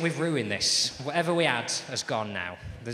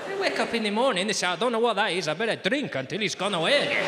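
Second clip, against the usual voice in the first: loud, higher Spanish storyteller; Spanish accent; 7 semitones higher, 6 dB louder than usual. Acted. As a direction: recount it indignantly in a Spanish accent. Spanish traveller mocks the locals.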